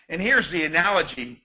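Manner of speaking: ordinary talk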